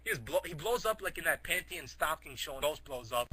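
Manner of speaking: ordinary delivery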